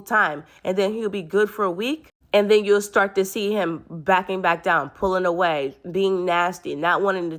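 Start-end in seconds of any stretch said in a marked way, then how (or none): none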